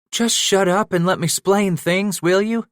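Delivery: pleading. Acted. Josiah begging Bass to let him explain, pleading. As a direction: beg him to just listen. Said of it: "he pleaded".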